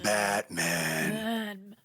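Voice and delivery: low rasp on the name; Batman voice. Shown as a demonstration. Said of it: "Batman voice".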